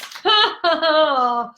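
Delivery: big open laugh. Real, not acted. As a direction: burst out laughing at yourself. Laughs at own question.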